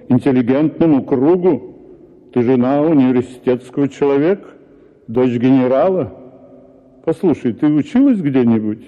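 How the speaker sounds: normal talking voice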